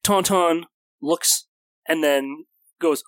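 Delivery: ordinary delivery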